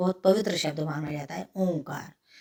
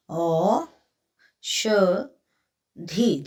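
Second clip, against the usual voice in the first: stretched word; 3 dB louder, much slower than usual. Shown as a demonstration.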